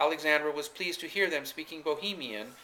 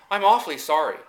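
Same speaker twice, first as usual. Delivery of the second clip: bright clear voice; 3 semitones higher, 7 dB louder than usual. A narrator's reading. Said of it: "he said in a bright, clear voice, with a rising inflection".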